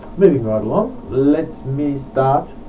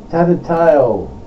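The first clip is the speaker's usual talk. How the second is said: clear, declaimed poem title; title announcement; 5 semitones higher, 9 dB louder than usual. Real, not acted. Title announced, then said over.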